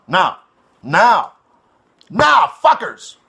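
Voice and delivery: rising call to the viewers ending in a shout; building shout. Real, not acted. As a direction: build it up and bellow the last one. Calling the viewers to attention.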